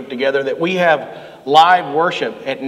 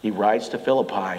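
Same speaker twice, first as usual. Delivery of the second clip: ordinary talk, nothing marked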